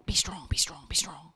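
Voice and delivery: fading, whispered be strong; whispered self-pep. Retold or imitated. To herself in a shakedown, fading whisper. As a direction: hush yourself steady, fading out. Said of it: "have a wee shakedown and go".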